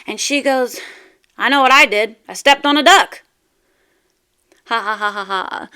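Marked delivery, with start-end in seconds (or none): 1.4-3.2 loud punchline. Acted. Punchline said by woman. 4.6-5.7 mock laugh. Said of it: "That's where the laughter comes in".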